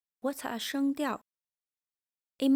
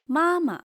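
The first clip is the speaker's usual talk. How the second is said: "妈妈" high first, then light, short and unstressed; neutral second syllable; 7 semitones higher, 8 dB louder than usual. Shown as a demonstration. Teacher demonstrating neutral tone, light ending. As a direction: let the second syllable of "妈妈" fall away lightly. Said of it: "second 妈 is light and unstressed".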